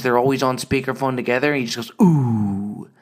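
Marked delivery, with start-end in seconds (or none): 2.0-2.9 low mock "ooh" of sympathy; deep drawn-out fatherly wince; dad's low groan. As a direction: groan like a wincing dad. Dad groaning on speakerphone.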